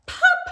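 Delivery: high sharp pop; popping sound. Acted. Reader imitating a button popping, sharp pop.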